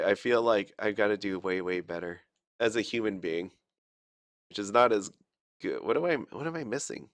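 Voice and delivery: usual speaking voice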